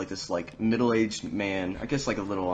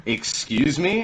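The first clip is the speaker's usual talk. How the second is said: higher, indignant retold question; mock offended; 7 semitones higher, 4 dB louder, much slower than usual. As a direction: act taken aback at the rudeness. Offended server answers rude customer.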